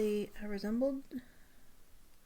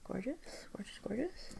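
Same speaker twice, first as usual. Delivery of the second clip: whispered, rising, softer; soft admiring whisper; 2 semitones higher, 9 dB quieter than usual. Real, not acted. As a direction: breathe it out in awe. Admiring swatch softly.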